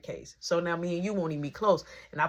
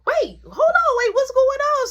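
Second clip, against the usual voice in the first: loud, much higher shout to stop a fight; alarmed call; 8 dB louder than usual. Retold or imitated. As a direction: yell out to break up the fight. Hears fight, calls out.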